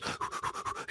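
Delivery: quick audible puffs of breath; mock mouth breathing. Guide mocks his mouth breathing, puffing exaggeratedly. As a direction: pant through the mouth, imitating bad breathing. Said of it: "you breathe like this".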